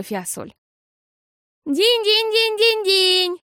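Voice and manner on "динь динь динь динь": slow, high ringing ding-ding; bell imitation. A narrator's reading. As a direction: sing out the bell's chime. Bell rung by sick girl.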